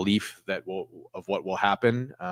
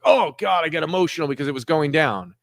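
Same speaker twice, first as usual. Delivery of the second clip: higher, louder mock fretting; mock panic; 7 semitones higher, 4 dB louder than usual. Trader imitating his own panic over a dropping stock, mock fretting. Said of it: "You're like".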